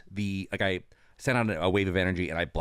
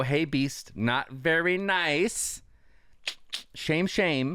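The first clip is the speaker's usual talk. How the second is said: higher, slow, teasing scold; mock scolding; 7 semitones higher, much slower than usual. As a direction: wag a finger at the Beast.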